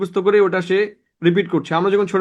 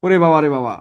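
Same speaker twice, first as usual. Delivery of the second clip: mock awe; 4 semitones lower than usual. Mocking a student's short answer.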